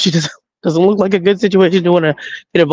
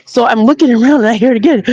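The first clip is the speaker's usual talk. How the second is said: ordinary delivery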